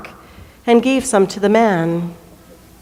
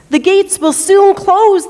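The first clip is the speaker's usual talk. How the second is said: insistent townspeople; 9 semitones higher, 3 dB louder than usual. Acted. Townspeople refuse to leave square.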